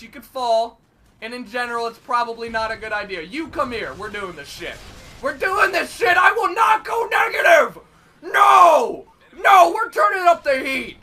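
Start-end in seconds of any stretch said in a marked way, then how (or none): 3.9-4.7 quiet, low resolve; muttered determination; low murmur. Real, not acted. Quiet first, then shouted. 5.2-11.0 loud, rallying yell; pumped shout. Real, not acted. Psyching up, shouting.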